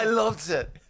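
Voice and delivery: put-on British accent. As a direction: say it like a posh TV judge. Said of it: "my British accent".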